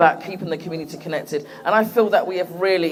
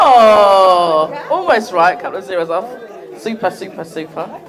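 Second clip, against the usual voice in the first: ordinary talk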